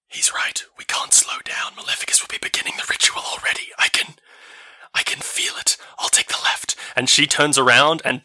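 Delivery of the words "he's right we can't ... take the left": hushed whisper voice; breathy urgent whisper; whispering Elva. Acted.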